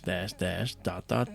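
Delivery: ordinary delivery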